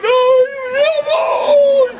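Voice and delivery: very high, loud mock bawling; wailing little brother. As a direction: bawl a tattling child's protest, hammed up. Little brother's acted wailing protest.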